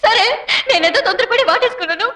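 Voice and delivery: ordinary talk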